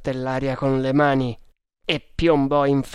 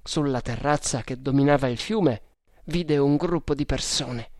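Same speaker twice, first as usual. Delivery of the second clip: usual speaking voice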